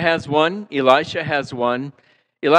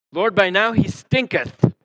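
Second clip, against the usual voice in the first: mock King James; 6 semitones higher than usual. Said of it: "I always thought that was great, hysterical".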